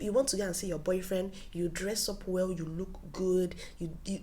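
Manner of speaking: normal talking voice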